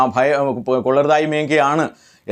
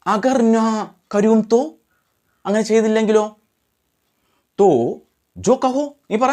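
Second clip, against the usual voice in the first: acted brothers' exchange; 7 semitones higher than usual. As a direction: act out a child's bargaining, pitched up. Acted exchange between the two brothers. (കുട്ടികളുടെ സംഭാഷണം, ഉയർന്ന സ്വരത്തിൽ)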